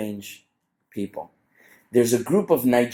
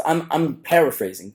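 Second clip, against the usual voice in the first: normal talking voice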